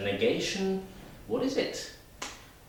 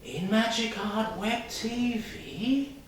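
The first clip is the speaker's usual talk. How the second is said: high, slow, astonished question; drawn-out amazed questioning; surprise; 12 semitones higher, slower than usual. Shown as a demonstration. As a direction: ask it in disbelief, rising at the end. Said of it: "say it with surprise".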